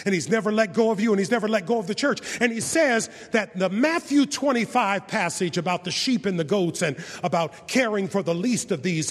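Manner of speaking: fast, loud preaching build; fervent preaching. Acted. Preacher rousing the congregation with loud preaching. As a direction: preach it to the rafters.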